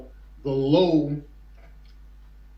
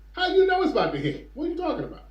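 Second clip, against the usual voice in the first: high-pitched, quick disbelieving questions; incredulous challenge; 11 semitones higher, faster than usual. Acted. Host questioning officials' prediction about Africa, incredulous. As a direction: challenge them in baffled disbelief.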